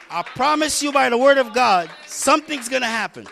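loud, high-pitched proclamation; emphatic preacher's promise. Real, not acted. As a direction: vow it forcefully to the congregation. Preacher promises congregation a move.

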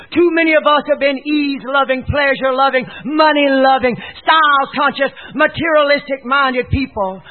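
loud, high, rebuking sermon; forceful pulpit denunciation; preach. Real, not acted. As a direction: hammer each item of the list.

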